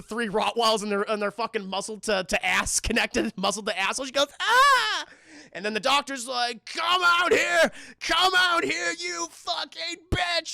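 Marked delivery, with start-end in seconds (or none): 4.4-5.1 high loud scream; a startled hammed-up scream; mock scream. [name] screams at dog picture. 6.7-10.5 high, loud, slow villain voice; menacing doctor shout. Retold or imitated. Doctor hunting her, voiced.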